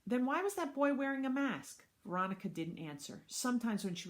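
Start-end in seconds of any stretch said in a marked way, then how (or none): 0.1-1.8 put-on high, louder puzzled child voice; little boy. Little brother asking about a mask, high child voice. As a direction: press the question like a small kid.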